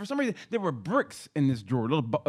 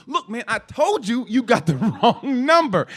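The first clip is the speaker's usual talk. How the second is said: higher, louder and slow, fed-up warning; annoyed dad on the phone; 7 semitones higher, 7 dB louder, slower than usual. Retold or imitated. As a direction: slowly lay it out to the caller again. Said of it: "He goes".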